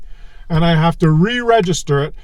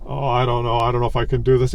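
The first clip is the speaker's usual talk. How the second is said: much lower and softer, grumbling to himself; weary husband groan; 7 semitones lower, 6 dB quieter than usual. Retold or imitated. Fed-up husband dreading the yearly re-register, low grumble. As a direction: sag into a tired mutter. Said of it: "you'll be sitting there saying".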